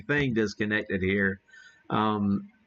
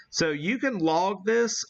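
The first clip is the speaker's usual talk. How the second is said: ordinary delivery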